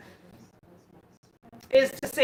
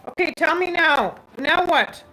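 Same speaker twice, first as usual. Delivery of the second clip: calling out; 3 semitones higher, 8 dB louder than usual. Real, not acted. Testing connection, calling out.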